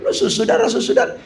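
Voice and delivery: "saudara" slurred as susudara; mumbled saudara. Shown as a demonstration. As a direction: swallow "saudara" carelessly. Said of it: "Pengucapan tuh harus jelas". ("saudara" diucapkan tidak jelas)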